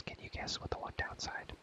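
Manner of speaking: ordinary talk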